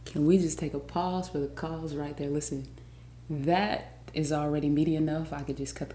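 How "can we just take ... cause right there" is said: ordinary talk, nothing marked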